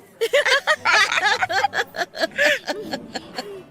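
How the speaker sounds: long, helpless high laughter; long fit of laughter. Acted. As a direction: laugh until you can't stop. Laughing hard at the joke.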